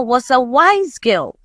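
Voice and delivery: ordinary delivery, nothing marked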